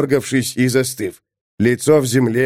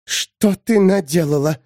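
hissed; 5 semitones higher than usual. A narrator's reading. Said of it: "прошипела она".

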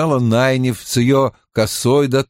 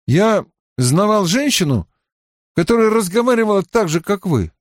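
higher, conversational character voice; raised pitch, casual probing; lightly voiced Mat; 9 semitones higher than usual. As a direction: feel her out with a lightly acted remark.

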